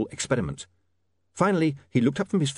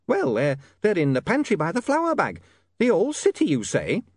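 high flustered landlady; shrill nagging landlady; 8 semitones higher than usual. Acted.

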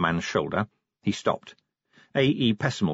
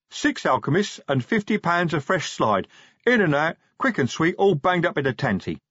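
pleased troll sergeant, pitched up; happy troll; 7 semitones higher than usual. A narrator's reading. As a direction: proudly list it in a troll voice.

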